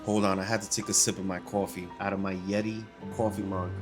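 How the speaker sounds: ordinary talk, nothing marked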